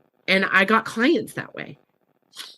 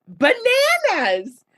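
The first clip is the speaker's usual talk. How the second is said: much higher and drawn out slowly; amazed exclaim; 14 semitones higher, much slower than usual. Real, not acted. Marvelling to the host that it worked, high slow exclaim. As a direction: stretch it out in delighted disbelief. Amazed at how easy it became.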